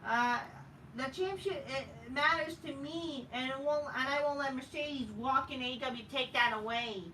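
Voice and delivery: mild sneering imitation; mocking wrestler quote. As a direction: parody her earnest speech. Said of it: "The fat whale bitch says".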